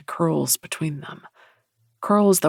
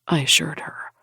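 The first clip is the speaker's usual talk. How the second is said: usual speaking voice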